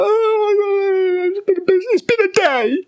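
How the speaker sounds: mocking high voice. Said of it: "She's like".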